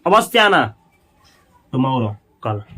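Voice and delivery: usual speaking voice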